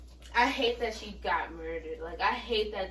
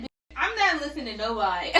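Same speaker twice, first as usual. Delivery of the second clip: louder retold refusal breaking into a laugh; laughing disbelief; 3 semitones higher, 7 dB louder than usual. Retelling her refusal to believe the friend's news, laughing. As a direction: brush it off, then crack up.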